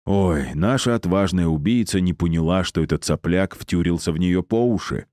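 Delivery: usual speaking voice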